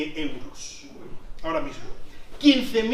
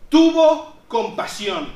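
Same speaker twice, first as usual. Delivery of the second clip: slow and louder, each word weighed; emphatic; 5 semitones higher, 8 dB louder, much slower than usual. Real, not acted. Preacher revealing the king's mercy, slow emphatic. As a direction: land the king's compassion slowly. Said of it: "climax of the parable".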